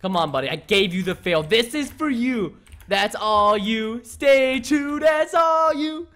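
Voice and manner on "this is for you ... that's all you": long, stretched-out cheer rising higher; rallying cheer. Real, not acted. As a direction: cheer a friend on, drawing out the words. Cheering on a friend in game.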